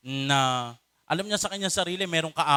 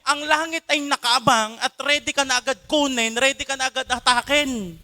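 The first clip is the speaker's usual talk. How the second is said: normal talking voice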